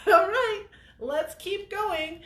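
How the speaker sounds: high, loud, breezy greeting; happy as a clam. Retold or imitated. Rolling up on a dirt bike, greeting breathless friends, cheery. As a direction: sing it out, cheerful and fresh, slow on the question. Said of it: "Just happy as a clam".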